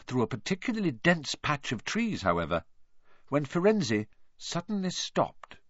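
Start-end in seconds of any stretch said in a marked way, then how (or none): none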